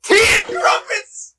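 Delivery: very high, slow mock British exclamation; British accent. Reading the hat's topic in a mock British accent. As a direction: squeal it like a posh British lady. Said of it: "speaking in British accents".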